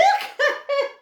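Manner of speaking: a high bright giggle of delight; delighted laugh. Real, not acted. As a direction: laugh out loud at the craft. Laughs at finished dog craft.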